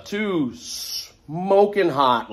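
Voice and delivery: very slow, stretched, pitch swooping high; long, savored drawl; drawn-out emphasis. Real, not acted. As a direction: linger on the words with relish. Relishing description of the EMTs.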